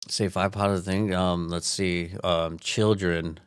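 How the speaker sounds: dragged out slowly, low and flat; slow, sarcastic reluctant listing; slow deadpan list. Pretend. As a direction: list them like it's a chore. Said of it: "slow mock listing".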